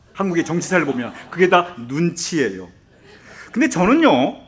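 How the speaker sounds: ordinary talk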